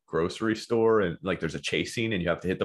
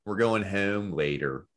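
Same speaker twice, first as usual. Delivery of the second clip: slow, exaggerated Philly accent; 2 semitones lower, slower than usual. Co-host practicing the O words, slow Philly accent. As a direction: lean on the Philly O in "home". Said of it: "if there's an O in a word".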